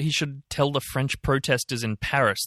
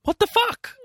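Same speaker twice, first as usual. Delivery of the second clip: very high disbelieving squeal; shocked squeal; 3 dB louder than usual. Real, not acted. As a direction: shriek of disbelief. Outraged at quote.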